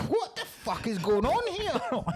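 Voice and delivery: slow, high baffled cry; high, drawn-out what the fuck; mock bewildered. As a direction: play the stunned goons. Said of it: "they're like".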